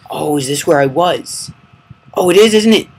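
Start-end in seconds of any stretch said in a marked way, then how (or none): 2.1-2.9 higher and louder, a sudden oh of recognition; excited surprise at finding the spot; surprised realization. Real, not acted. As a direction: catch it as it dawns on you. Recognizes the place he died.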